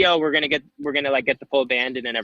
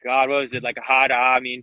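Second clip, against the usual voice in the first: usual speaking voice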